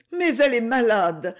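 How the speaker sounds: douce supplique, sans chuchoter; suppliant. A narrator's reading. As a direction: plaide timidement pour la malade. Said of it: "murmura Inès d'un ton suppliant". (ton légèrement suppliant)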